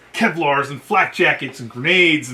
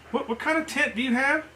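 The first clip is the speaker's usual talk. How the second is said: much higher and softer, disbelieving; incredulous colonel; 10 semitones higher, 5 dB quieter than usual. Retold or imitated. Colonel to the staff sergeant, softly incredulous. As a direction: ask as if you misheard something absurd. Colonel stunned by shelter halves.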